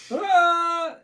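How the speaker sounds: short high yelp of shock; cartoon scream. A narrator's reading. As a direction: shriek as the eyes pop out. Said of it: "screams as his eyes pop".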